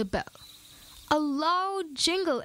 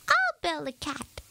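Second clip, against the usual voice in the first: small determined voice; 4 semitones higher than usual. A narrator's reading. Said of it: "his voice small but determined".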